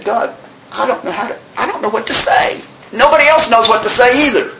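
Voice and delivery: high-pitched, pleading prayer; helpless plea. Acted. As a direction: confess to God you are lost for words. Retold desperate prayer in meeting.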